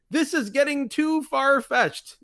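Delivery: high, slow mock wrestler line; mock Dick Slater quote. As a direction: quote him in a high drawn-out voice. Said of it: "is the exact quote".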